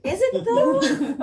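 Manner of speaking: high, slow, laughing doubt; skeptical. Retold or imitated. Mocking people who call French pretty, high skeptical drawl. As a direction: drag it out like you don't buy it. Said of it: "and I'm like".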